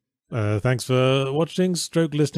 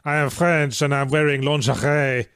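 throaty, mucusy French R's; French accent; 3 semitones higher than usual. Shown as a demonstration. Showing a co-host how to do the accent, throaty French accent. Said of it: "back of the throat thing in on all the R's".

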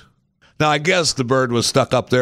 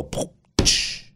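breathy poof and tsh; whispered crash noise; 4 dB quieter than usual. Retold or imitated. Cage bottom hitting the sidewalk, whispered crash sound. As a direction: imitate the cage bottom dropping. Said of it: "You see the bottom go".

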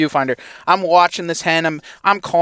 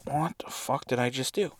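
muttered self-reproach; 2 semitones lower, 14 dB quieter than usual. Retold or imitated. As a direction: say it quietly to yourself, crestfallen. Self-reproach after the miss.

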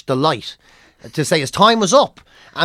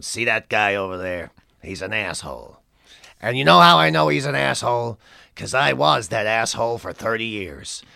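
much lower, gruff-edged Canadian comic voice, weary and done with everything; Mike Wilmot's Canadian accent; 9 semitones lower, 4 dB quieter than usual. Retold or imitated. A veteran Canadian comic sneering about a young act, in a low Canadian accent. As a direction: do the jaded old Canadian comedian. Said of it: "I'll do it in his accent".

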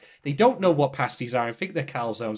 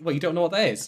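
normal talking voice

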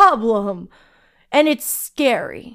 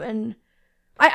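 usual speaking voice

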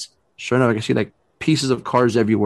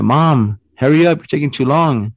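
louder, higher call through the door; 3 semitones higher, 5 dB louder than usual. Retold or imitated. Calling through the door to the thing inside, loud call. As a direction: call into the house as if to his mom.

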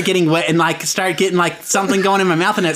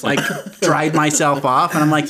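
usual speaking voice

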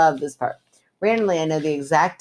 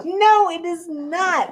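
much higher, slow, drawn-out "no"; mock indignant denial; 12 semitones higher, much slower than usual. Real, not acted. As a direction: protest in a high, stretched-out voice. Friend rejecting the show playfully.

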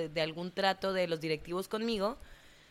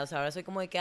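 ordinary talk

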